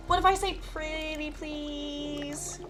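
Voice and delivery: high, slow, drawn-out begging; wheedling plea. Acted. Witch begs Jack to test the potion, wheedling. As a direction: stretch out a cutesy plea.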